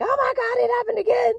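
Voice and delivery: high-pitched, loud mock excitement; excited outburst. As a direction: squeal it like an overexcited newcomer acting out the thrill. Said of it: "initial period of excitement".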